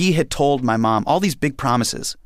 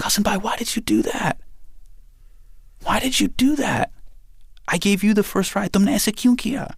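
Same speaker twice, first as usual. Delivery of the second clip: put-on high, tearful child's voice; crying little sister; 10 semitones higher than usual. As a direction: plead like a hurt little girl. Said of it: "she's just crying".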